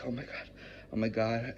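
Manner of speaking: hushed, frightened small voice; scared. Acted. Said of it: "I'm, like, scared".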